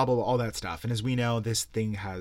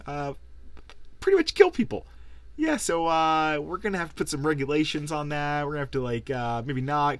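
mock regulator at the door; 5 semitones higher than usual. Neighbors telling Big Tobacco, in a high mock voice. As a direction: play a fake-friendly official breaking bad news, higher. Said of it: "people came to their door and was like".

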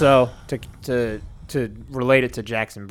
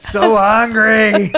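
high, loud, drawn-out wail; hammed-up starving complaint, stretched slow; mock hungry wail; 8 semitones higher, 5 dB louder, much slower than usual. Friend mimics a starving girlfriend, hammed-up hungry wail.

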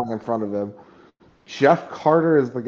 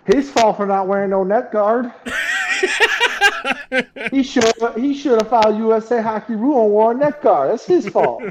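mock indignant coach; 9 semitones higher than usual. Joining the mock coach rant.

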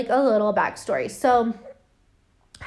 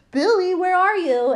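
high, loud stagey calling out; hammed-up ventriloquist; 8 semitones higher, 6 dB louder than usual. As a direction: call out to a puppet, pitched high. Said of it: "doing the whole ... Billy the Puppet ventriloquist bit".

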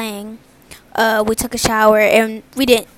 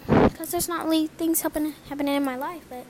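normal talking voice

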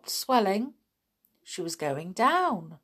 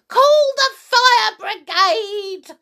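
high, drawn-out alarmed yell; sudden shout; 13 semitones higher, 9 dB louder than usual. A narrator's reading. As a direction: shout for help at the top of your voice. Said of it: "she shouted suddenly".

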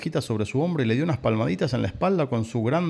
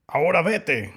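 raised, high-pitched order; wolf's shout; 6 semitones higher, 4 dB louder, slower than usual. A narrator's reading. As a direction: shout the lamb out the door. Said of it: "gritó". (orden a gritos)

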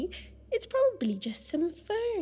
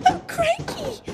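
much higher startled yelp; 12 semitones higher, 5 dB louder than usual. Acted. Lisa startled as the painting falls, high yelp. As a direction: jump at the crash.

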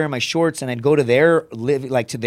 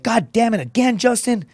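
higher-pitched mock scolding; exasperated scolding; 8 semitones higher than usual. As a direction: imitate an exasperated grown-up, pitched up. Exasperated adult in retold scene.

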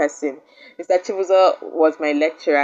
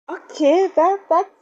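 surprised; 3 dB louder, slower than usual. Real, not acted. Reacting to friend's answer.

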